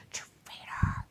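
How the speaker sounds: whispered teasing "Traitor"; mock whisper. Real, not acted. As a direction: hiss it as a playful accusation. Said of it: "Just kidding".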